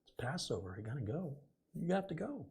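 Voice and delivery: high-pitched nagging dad voice; imitating worried dad. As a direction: fret like a dad worried about appearances. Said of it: "I used to hear my dad talk".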